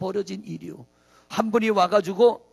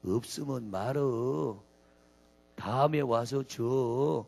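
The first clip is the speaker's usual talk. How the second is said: very slow, lower Chungcheong owner; slow Chungcheong drawl; 8 semitones lower, 6 dB quieter, much slower than usual. Retold or imitated. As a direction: stretch every word lazily in Chungcheong dialect. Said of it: "주인이 하는 얘기가". (아주 느린 충청도 사투리로)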